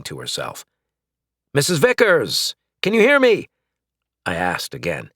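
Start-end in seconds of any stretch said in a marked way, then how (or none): 1.5-3.5 high, loud call. Acted. Flint calling through the collar to his neighbor, loud. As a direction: raise the voice to reach her.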